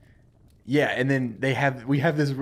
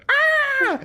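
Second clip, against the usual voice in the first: shrill startled shriek; high scream; 7 dB louder than usual. Acted. The lady sees the skeleton and shrieks high. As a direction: scream in fright. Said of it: "turns to see the skeleton and goes".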